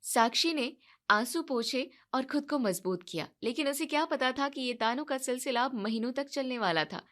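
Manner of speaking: ordinary delivery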